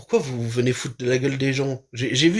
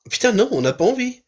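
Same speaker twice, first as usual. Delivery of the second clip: excited outburst; 10 semitones higher than usual. Real, not acted. Reacting to the speech on mocking accents, excited indignant outburst. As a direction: pitch jumps high, a heated burst of agreement.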